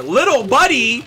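delighted greeting. Real, not acted. Streamer spotting little buddy in the game, delighted.

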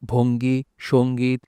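ordinary talk

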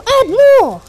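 high, loud insistent demand; excited demand. Real, not acted. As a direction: push hard for a bigger offer. Urging the trader to add.

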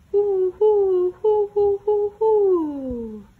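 loud high hooting owl call; great horned owl hoot. Acted. Pa calling to owls in the night, loud high hooting. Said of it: "Then he called".

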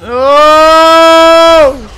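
high loud startled oh; startled shout. Real, not acted. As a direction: jump in shock.

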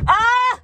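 short, high, loud shriek of alarm; panicked scream. Real, not acted. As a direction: yelp as the car rolls back. Panicking while stalling on hill.

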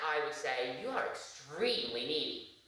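normal talking voice

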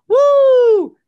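loud high whoop; host cheering on his plug; hype woo. Real, not acted. Hype shout mid plug.

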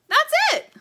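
high, loud cry of recognition; amazed gasp. A narrator's reading. Little bird recognizing the sketch of her house, gasped and excited. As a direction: burst out in astonished delight. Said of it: "the little bird gasped".

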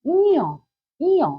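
ইয় as high, long "ee" gliding to "o"; careful pronunciation. Shown as a demonstration. Teacher models ইয় for pupils to repeat, high and carefully drawn out. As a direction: say ইয় as a stretched "ee-yo", pitched high. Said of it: "আমি উচ্চারণ করব".

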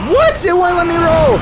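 high, loud outraged yell; frustrated shout. Real, not acted. Player yelling at the game in frustration, shouting. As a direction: cry out at the game that cheated you. Boss fight, dodge fails.

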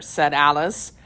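usual speaking voice

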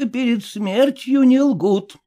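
put-on high, slow stern old dame; a touch of a stern old lady. A narrator's reading. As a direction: admonish like a strict grandmother. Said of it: "строго сказала старуха".

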